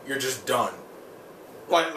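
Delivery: ordinary delivery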